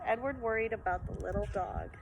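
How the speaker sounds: ordinary delivery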